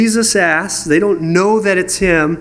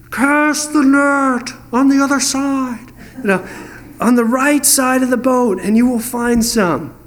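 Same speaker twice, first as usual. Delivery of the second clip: Jesus voiced; 6 semitones higher than usual. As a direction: call it out across the water, patient. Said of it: "imagine this".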